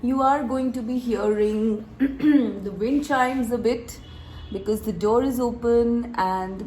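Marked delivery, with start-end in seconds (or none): none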